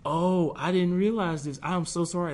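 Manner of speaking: recounting a heartfelt "oh" of regret; retold apology. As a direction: act out a sudden, apologetic realization. Retelling his apology to God.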